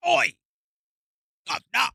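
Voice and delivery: high, loud mock Cockney call; hammed-up London shout; mock British accent. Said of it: "how British does it sound?".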